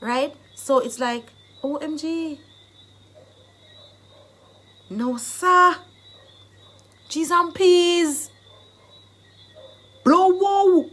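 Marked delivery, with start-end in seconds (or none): 1.6-2.4 higher, lightly acted excitement; excited exclamation. Shown as a demonstration. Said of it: "showing you being excited for different reasons". 4.9-10.9 high, loud amazed outburst; acted, whooping excitement; excited exclamation. Shown as a demonstration. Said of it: "it's exciting. It's excitement".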